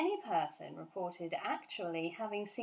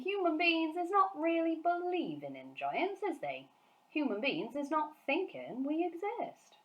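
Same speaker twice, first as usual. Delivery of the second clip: higher, lively friendly giant; BFG's voice; 9 semitones higher than usual. Acted. Giant telling Sophie humans doubt giants, giant voice. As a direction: play the big friendly giant, earnest.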